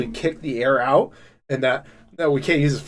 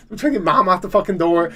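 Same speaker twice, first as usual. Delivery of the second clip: normal talking voice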